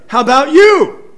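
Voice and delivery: high, punched mock call-out; loud challenge. David turns on Abner, loudly challenging him. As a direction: throw the question out high and loud.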